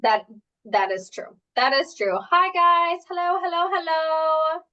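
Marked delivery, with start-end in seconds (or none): 2.3-4.6 high, bright sing-song hello to the viewers; cheery greeting. Real, not acted. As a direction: call out hello, hello up high and lively.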